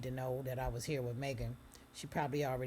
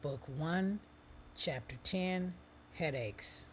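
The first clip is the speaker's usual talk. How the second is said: title announcement; 4 semitones higher, slower than usual. Real, not acted.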